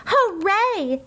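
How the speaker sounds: high happy cheer; a little girl's eager hooray; excited cheer. Acted. Girl running to her first day, cheering high.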